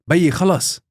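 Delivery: high, loud retold call to stop; urgent plea. Child calling her dad off a fight, high loud urgent plea. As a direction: call out sharply to pull dad back, mock-urgent.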